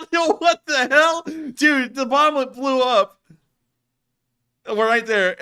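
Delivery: stunned yell at the screen; excited disbelief. Real, not acted. Watching the drone bomb blow up, loud amazed outburst.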